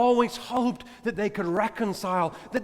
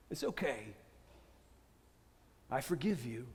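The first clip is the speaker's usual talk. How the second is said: much softer and lower, tender reassurance; gentle forgiveness; 7 semitones lower, 12 dB quieter than usual. Retold or imitated. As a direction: soothe him quietly, it's okay. Each colleague repeats the forgiveness.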